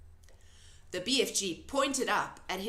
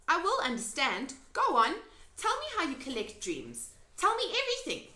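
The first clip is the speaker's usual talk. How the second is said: put-on higher, eager pleading girl; Sophie voice; 7 semitones higher, faster than usual. A narrator's reading. Sophie begging the giant to explain, eagerly. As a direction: coax him to tell.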